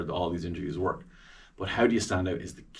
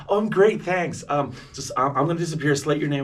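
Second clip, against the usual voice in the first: lightly voiced, raised pitch; pleased, higher-pitched reply; tech person's reply; 7 semitones higher than usual. Tech person answering the interviewer on Zoom, lightly voiced and high.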